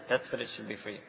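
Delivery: normal talking voice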